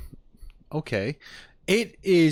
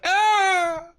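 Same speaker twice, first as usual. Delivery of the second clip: Link; 6 dB louder than usual. Retold or imitated. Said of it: "when he, when he gets hurt".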